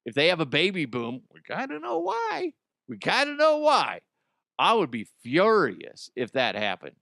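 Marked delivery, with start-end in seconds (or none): 1.3-2.5 mock high voice. Mocking high voice joke. 2.9-4.0 mock high voice. Repeat louder, high.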